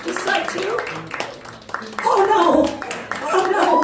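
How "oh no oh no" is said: mock horror. Discovering crepey skin.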